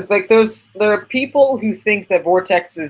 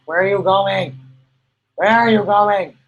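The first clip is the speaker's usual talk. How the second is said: louder call after a wandering dog; 5 semitones higher, 3 dB louder than usual. Real, not acted. Calling after her dog.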